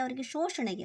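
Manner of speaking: ordinary delivery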